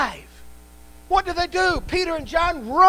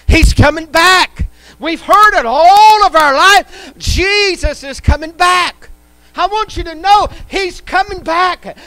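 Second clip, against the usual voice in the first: preaching at full force; 5 semitones higher, 8 dB louder than usual. Acted. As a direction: drive it home to the congregation. Preacher building to climax.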